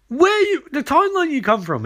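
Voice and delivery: high-pitched acted challenge; mock confrontation. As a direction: demand an answer in hammed-up high indignation. [name] angrily challenges Elbard.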